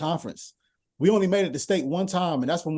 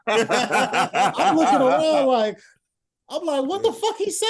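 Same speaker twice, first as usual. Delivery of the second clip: high cracking laugh into a baffled quote; laughing disbelief; 10 semitones higher, 6 dB louder, slower than usual. Real, not acted. Son laughing as he retells the coach's baffling play call. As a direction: laugh through the confusion.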